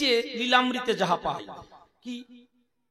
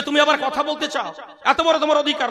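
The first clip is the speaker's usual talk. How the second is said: louder, higher indignant teacher, half laughing; mock outrage; 4 semitones higher, 6 dB louder than usual. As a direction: play the outraged teacher, not quite straight. (হাসিমাখা নাটুকে রাগ)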